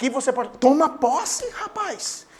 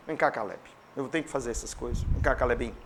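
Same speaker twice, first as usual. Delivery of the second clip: lower, softer coaxing; playful Satan voice; 11 semitones lower, 9 dB quieter than usual. Pretend. Preacher playing Satan grabbing Caleb, low soft coaxing. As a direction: coax the boy like a teasing devil.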